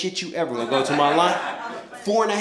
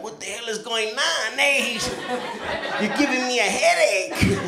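confused bullies; 5 semitones higher, slower than usual. Retold or imitated. Confused guys, imitated.

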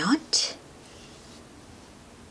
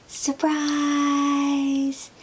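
high, bright cry of surprise; excited surprise; 10 semitones higher, 3 dB louder than usual. Acted. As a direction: burst out with delight. Elmo surprise shout.

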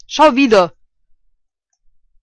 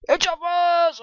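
excited; 9 semitones higher than usual. Real, not acted. Hyping himself up.

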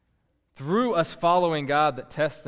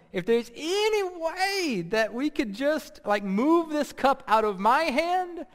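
Jesus in the garden pleading, voice raised and straining; anguished pleading prayer; 6 semitones higher than usual. Acted. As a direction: beg the Father to take the cup away. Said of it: "he's crying to his father".

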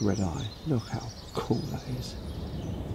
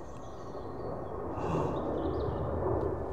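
amazed gasp; 3 dB quieter than usual. Real, not acted. Amazed at sparrowhawk.

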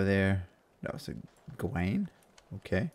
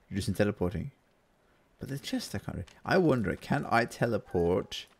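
ordinary talk, nothing marked

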